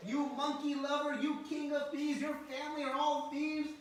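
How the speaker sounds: high, loud mock chastising; Mother Yashoda. Mother calls names while chasing Krishna, loudly chastising. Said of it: "she is chastising Krishna".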